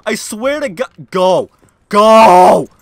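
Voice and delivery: high, loud rant building to screams; enraged shouting. Real, not acted. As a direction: yell in mounting fury at the game. Raging at a broken ladder.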